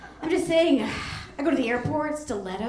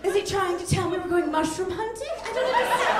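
baffled disbelief; 12 semitones higher than usual. Retold or imitated. Woman staring at an unsolicited photo, high-pitched baffled squeal. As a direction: rise up in total confusion.

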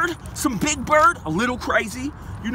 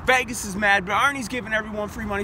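ordinary talk, nothing marked

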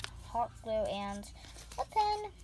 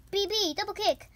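excited move call; 8 semitones higher, 5 dB louder than usual. Pretend. Calls move during play.

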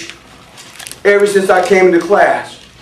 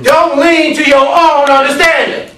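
high, raised preacher's cadence; forceful preaching; 8 semitones higher, 5 dB louder than usual. Real, not acted. Preacher warns the class what to preach, forceful preaching. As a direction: hammer each phrase out to the congregation.

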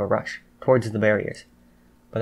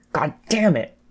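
higher exasperated outburst; frustrated cry; 5 semitones higher, 4 dB louder than usual. A narrator's reading. Jiro snatches up the penalty cards, frustrated cry. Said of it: "Jiro cried".

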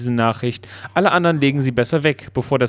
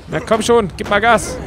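higher, louder hurry-up push; urging on; 8 semitones higher, 3 dB louder than usual. Real, not acted. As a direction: egg the driver on. Urging slow driver companion.

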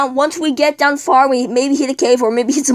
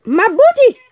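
high, laughing leprechaun guarding gold; 11 semitones higher, 4 dB louder than usual. Pretend. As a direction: squeal it like a cheeky leprechaun. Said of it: "I'm a leprechaun".

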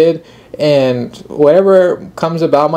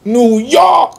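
high, loud hype cheer for New York; mock New York street holler; 10 semitones higher, 4 dB louder, much slower than usual. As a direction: belt it out like a street hype man. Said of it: "I was trying to do that, this guy's accent".

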